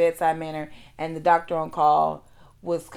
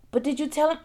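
high-pitched nurse; 10 semitones higher, faster than usual. Retold or imitated. Nurse asks the patient, high and brisk. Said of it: "and she said".

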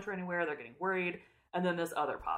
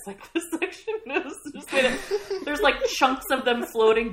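giggling through the words, higher; laughing; 7 semitones higher, 5 dB louder than usual. Real, not acted. Host cracking up over the gross chapter, laughing. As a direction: laugh while you try to go on.

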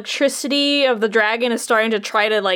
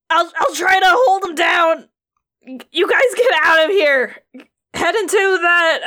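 heroic shout; 5 semitones higher than usual. Acted. GM voices Rad holding the dragon.

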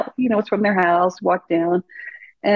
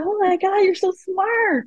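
mock gushing praise; 11 semitones higher, slower than usual. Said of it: "I'm like".

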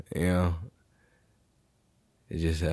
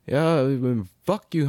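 ordinary talk, nothing marked

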